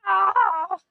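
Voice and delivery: loud high yelp of pain. Real, not acted. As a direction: cry out sharply as hands burn. Burning hands on hot cake.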